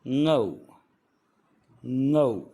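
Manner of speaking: ngou with the tongue middle on the palate, not a plain n. Shown as a demonstration. Teacher drills ngou for learners, firm and loud. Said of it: "यहाँ पर उच्चारण है ngou".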